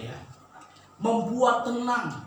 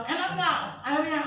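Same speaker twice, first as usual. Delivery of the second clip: mother's question; 7 semitones higher than usual. Retold or imitated. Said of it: "Ibu saya bilang".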